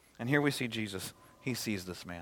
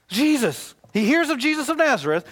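ordinary talk, nothing marked

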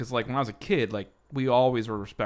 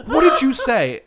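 much higher, louder sharp teacher voice; stern teacher; 12 semitones higher, 9 dB louder, slower than usual. Retold or imitated. [name] voicing his first-grade teacher confronting him, high and loud. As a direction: demand it like a startled teacher.

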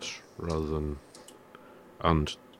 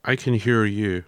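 loud, raised reply down a line; shouting into a phone; 4 semitones higher, 8 dB louder than usual. Real, not acted. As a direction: call it out to be heard. Skype call cut out.

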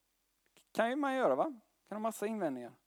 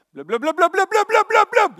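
loud, much higher bubbling blubb blubb; underwater shouting; 13 semitones higher, 15 dB louder than usual. Retold or imitated. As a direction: yell like drowning under water. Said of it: "så här". (högt bubblande rop under vatten)

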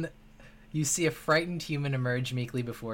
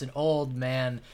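ordinary delivery

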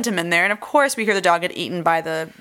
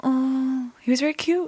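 tender coo; 6 semitones higher than usual. Real, not acted. Friend cooing over the bulldog. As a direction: gush about a cute pup. Gasps at the dog.

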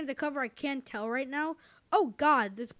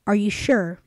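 game show suspense; 5 semitones lower, 9 dB louder than usual. Acted. Quiz host suspense.

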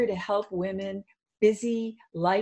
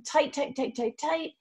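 higher-pitched, tense rapid repetitions; urgent squeezing cue; 8 semitones higher than usual. Shown as a demonstration. Coach leading a full-body muscle squeeze, urgent and tense. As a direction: urge them to squeeze harder with each word.

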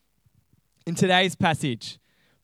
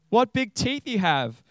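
put-on higher, wondering little-girl voice; 6 semitones higher than usual. Girl marvels at disguised wolf's teeth, high girlish voice. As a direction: pitch it up like an amazed child.

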